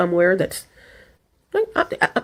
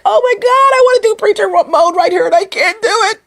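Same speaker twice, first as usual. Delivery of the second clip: high squeaky self-quote; mock high whine; 13 semitones higher than usual. Mock high whine quoting herself. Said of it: "so I was like".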